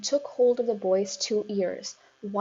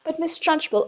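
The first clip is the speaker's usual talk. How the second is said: higher, pleading; teacher protest; 7 semitones higher, 5 dB louder than usual. A narrator's reading. Teacher interrupting the headmistress, higher. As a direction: try to protest.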